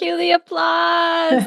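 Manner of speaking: high, drawn-out whoop of praise; celebratory cheer. Real, not acted. Host calls for applause after the story, cheering. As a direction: call it out slowly like a cheer.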